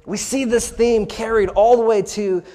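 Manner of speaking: ordinary talk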